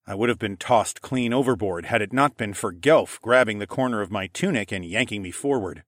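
plain reading voice

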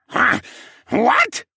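high, loud squawky screech. A narrator's reading. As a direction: screech in disbelief. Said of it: "he screeched".

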